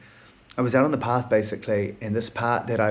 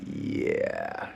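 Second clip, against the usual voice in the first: sheepish admission; 7 dB quieter than usual. Retold or imitated. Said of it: "I'm like".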